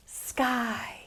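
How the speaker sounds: whispered final word. Shown as a demonstration. Teacher whispers the rhyme's ending to the children. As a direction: drop to a whisper on the last word.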